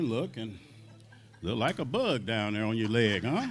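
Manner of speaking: low, soft, fatherly boss voice; B B King's voice. Retold or imitated. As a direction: drop low and quiet like a calm elder scolding. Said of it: "He said".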